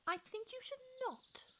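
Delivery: put-on high girlish reply; Mamie. A narrator's reading. Mamie answering the plants, high girlish voice. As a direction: answer lightly as the little girl. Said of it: "Mamie replied".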